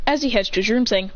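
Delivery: ordinary delivery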